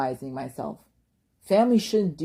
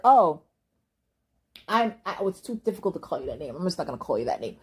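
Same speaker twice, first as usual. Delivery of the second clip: mocking dismissive quote; faster than usual. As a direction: rattle off the excuse like you can't be bothered. Mocking people refusing her name.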